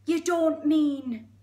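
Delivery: loud, high, drawn-out aghast Geordie; Geordie hippo. Acted. Hippo realising the crocodile's plan, aghast Geordie. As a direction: draw out the horror in Geordie. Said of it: "It's really Geordie".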